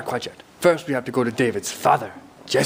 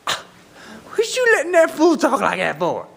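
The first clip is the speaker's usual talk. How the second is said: high, streetwise little-guy squawk; indignant pipsqueak talking big; David's voice; 12 semitones higher than usual. Acted. Storyteller as little David outraged at the giant, high indignant voice. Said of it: "Because that's how he talks".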